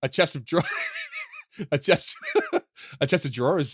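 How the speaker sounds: laughing through the words; cracking up. Real, not acted. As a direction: try to get it out between bursts of laughter. Cracks up while trying to explain.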